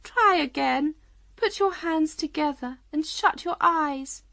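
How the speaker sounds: high-voiced, coaxing Queen. A narrator's reading. Queen teaching Alice to believe, coaxing. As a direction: coach gently in a high voice.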